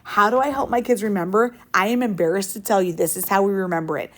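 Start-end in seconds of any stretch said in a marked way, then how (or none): none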